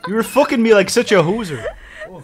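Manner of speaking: mock Canadian accent. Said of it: "Canadian porn".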